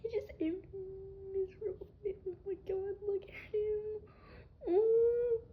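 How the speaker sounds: cooing pet voice. Real, not acted. Cooing at her pet bird.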